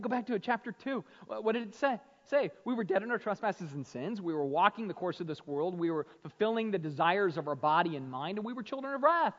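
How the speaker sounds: loud, high, driving sermon voice; fired-up pulpit delivery; full-force preaching. Acted. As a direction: preach it out to the back row. Preaching climax.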